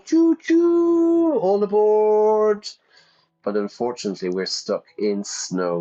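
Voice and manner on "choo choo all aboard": high, loud, drawn-out train whistle and conductor's call; playful train call. Pretend. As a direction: toot and call like a departing train. Mimics train as it departs.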